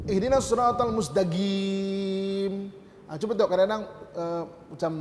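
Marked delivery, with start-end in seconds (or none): 0.1-2.7 Yemeni Arabic accent, slowly recited, Qaf said as Ga. Shown as a demonstration. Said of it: "qaf tu jadi ga".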